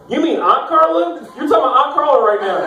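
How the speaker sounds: high, incredulous outburst; shocked disbelief. Acted. Nephew learning uncle means his aunt, incredulous. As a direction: pitch it up, appalled and disbelieving.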